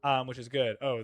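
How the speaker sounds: ordinary talk, nothing marked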